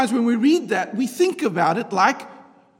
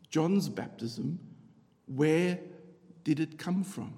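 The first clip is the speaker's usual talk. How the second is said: softer, slow, reflective; quiet slow repeat; 4 semitones lower, 6 dB quieter, slower than usual. Shown as a demonstration. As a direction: repeat it softly and slowly.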